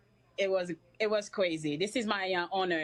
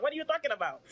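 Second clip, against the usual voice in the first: laughing; 6 semitones higher, faster than usual. Retold or imitated. Retold surprise, then laughs.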